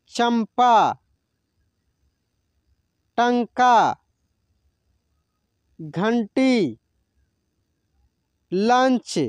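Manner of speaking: each word said alone, careful and drawn out; slow teacher-style list reading. Shown as a demonstration. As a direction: model every word for students to repeat. Second word list read aloud.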